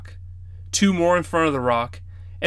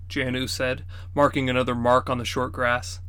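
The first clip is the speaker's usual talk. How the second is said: usual speaking voice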